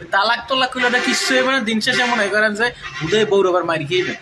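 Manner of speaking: normal talking voice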